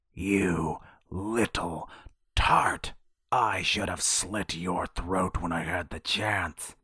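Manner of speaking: slow, snarling hag threat; old sea hag voice. Acted. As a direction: spit the insult out in fury.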